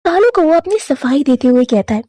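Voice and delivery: ordinary delivery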